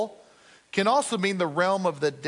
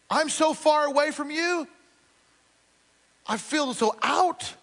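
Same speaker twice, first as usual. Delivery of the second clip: desperate plea; 7 semitones higher than usual. Acted. Voicing Jonah's plea to God.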